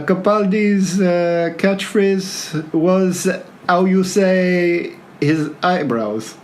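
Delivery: put-on very slow, halting French accent; mock French, groping slowly through the words.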